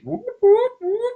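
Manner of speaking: very high, loud whooping siren; imitated alarm. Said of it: "their alarms went off crazy".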